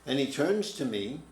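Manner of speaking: ordinary talk, nothing marked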